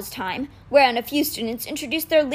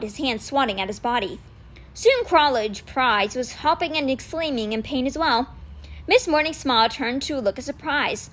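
ordinary delivery, nothing marked

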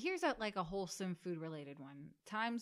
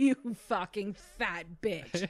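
mock chef insult; 8 dB louder, slower than usual. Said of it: "Chef Ramsay".

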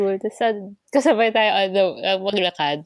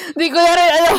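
laughing; 7 semitones higher, 6 dB louder than usual. Real, not acted. Laughing after awkward story.

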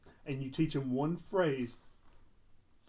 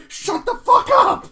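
very high, loud, drawn-out parrot screech; swearing parrot; 16 dB louder than usual. Retold or imitated. Imitating a parrot that learned a bad word, loud slow screech. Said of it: "it learns a bad word".

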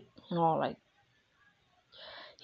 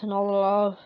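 ordinary talk

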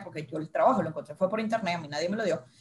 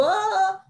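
mock wail; 10 semitones higher than usual. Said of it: "Me tiro en el piso a llorar y digo".

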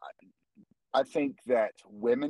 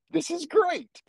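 thrilled; slower than usual. Retold or imitated. Thrilled at daughter's reply.

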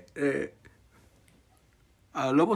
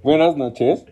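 loud, overly formal call-center voice; fake bank operator; 9 dB louder than usual. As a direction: play a stiff bank clerk on the phone.

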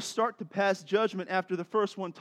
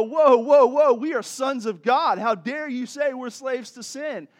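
mock-outraged, louder and higher; indignant protest; 6 semitones higher, 8 dB louder than usual. The crowd objects to being called slaves, indignant. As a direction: cut in with affronted whoas.

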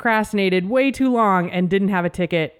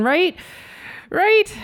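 second "Right?" much higher; pleading for agreement, higher; rising unsure "right"; 11 semitones higher than usual. Real, not acted. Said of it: "self-doubt, rising".

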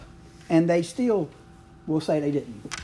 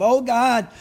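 high, loud boastful prayer; a self-satisfied Pharisee showing off to God; preening Pharisee praying, louder and higher; smug Pharisee; 5 semitones higher, 3 dB louder than usual. Retold or imitated. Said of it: "praying like this about himself".

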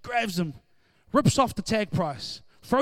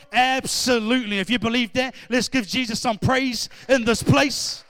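loud, rising preacher's cry, driving each line home; full-force preaching; 4 semitones higher, 8 dB louder than usual. Real, not acted. Preacher rousing the church to praise, loud, fervent preaching. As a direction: preach it hard to the congregation.

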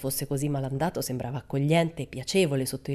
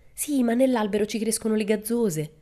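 imploring; 6 semitones higher, 3 dB louder than usual. Acted. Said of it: "disse implorante".

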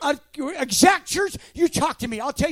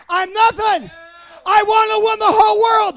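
high, loud preaching shout; preaching climax; 6 semitones higher, 6 dB louder than usual. Real, not acted. Pastor telling the church his goal, higher and louder. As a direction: proclaim it to the rafters.

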